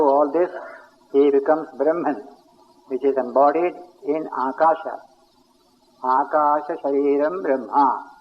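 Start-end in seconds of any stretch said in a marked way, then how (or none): 6.0-8.1 slow, reverent Sanskrit recitation; slow serene guru pauses. Shown as a demonstration. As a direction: savour the words, slow and calm. Recites Sanskrit phrase slowly.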